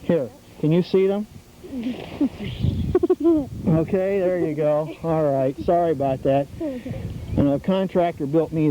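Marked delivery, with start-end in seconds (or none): 1.6-3.6 a high, real laugh that breaks out; burst of laughter. Real, not acted. Nature host laughing with the scouts.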